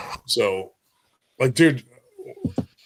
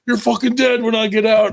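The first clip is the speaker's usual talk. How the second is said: higher, louder imitated yell of furious inmates; mock angry threat; 11 semitones higher, 5 dB louder than usual. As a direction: throw the threat like angry inmates across the yard. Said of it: "all the guys that missed their visit were like".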